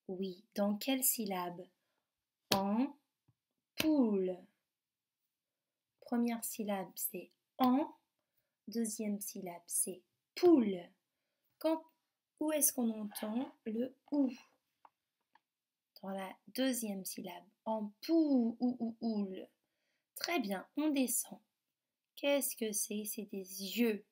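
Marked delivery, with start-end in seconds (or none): 2.5-4.4 "Ampoule" very slowly, split as "am... poule"; teacher breaking "Ampoule" into two syllables. Shown as a demonstration. 17.7-19.5 "ampoule" with OU pulsed, "am-pou-ou-oule"; teacher stretching "ampoule" on its OU; vowel echoed. Shown as a demonstration. Repeating the vowel inside the word.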